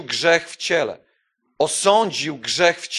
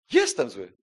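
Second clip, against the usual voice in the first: low, plain, resigned; 4 semitones lower than usual. Acted. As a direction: say it simply, accepting it. Repeating the admission quietly.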